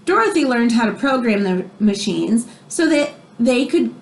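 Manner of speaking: expressive read-aloud storybook voice; reading to children. Acted. Teacher reading a biography to her class, expressive read-aloud. Reads picture book to class.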